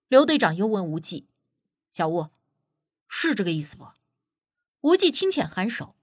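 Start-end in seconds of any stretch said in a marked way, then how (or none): 1.9-3.9 low, softer captain's question; captain checking. Acted. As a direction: ask quietly for confirmation, pitched down. Captain checks his explanation with Wuji.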